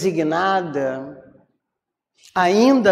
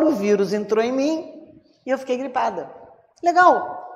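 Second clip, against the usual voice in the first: higher-pitched, playful sarcasm; mock cheerful irony; 8 semitones higher than usual. Speaker joking that the virus loved her, mock cheerful irony. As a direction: make light of it in a higher voice. Sarcastic account of catching flu.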